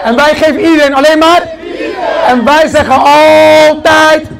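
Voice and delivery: loud, high, slow hype chant; crowd-rousing outro shout; shouted slogan. Acted. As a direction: drag each word out at full volume.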